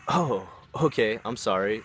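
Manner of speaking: drawn-out slow oh, then sorry; taken-aback apology. Retold or imitated. Taken-aback reply to aggressive friend.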